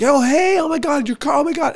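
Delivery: very high-pitched mock excitement; squealed, put-on recognition; fake gushing greeting. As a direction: gush as if greeting old friends. Feigned greeting of unknown girls.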